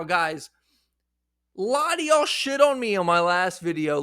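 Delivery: ordinary delivery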